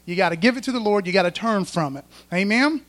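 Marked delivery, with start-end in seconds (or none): none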